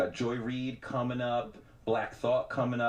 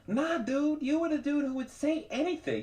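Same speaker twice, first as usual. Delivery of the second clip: imitating rappers. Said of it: "they would probably be like".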